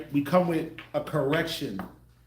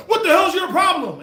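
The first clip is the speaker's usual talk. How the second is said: high, loud shouted snap; mock angry mom; 12 semitones higher, 9 dB louder than usual. Mocking a mother yelling at her son. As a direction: yell it like a fed-up mother. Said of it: "Or you come with the".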